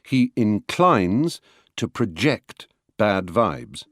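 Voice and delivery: "inclines" and "project" slowly, pushed on second syllables; verb stress. Shown as a demonstration. Lecturer showing stress by meaning, verbs "inclines" and "project" stressed at the end. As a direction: say in-CLINES and pro-JECT. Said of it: "stress will change according to the meaning".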